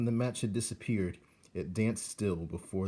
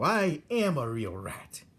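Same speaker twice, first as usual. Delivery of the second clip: higher, slow and proud; young rat's vow; 6 semitones higher, 5 dB louder than usual. Acted. Young rat proudly agrees he is a real rat, slow. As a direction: claim it slowly with pride. Roscuro's voice.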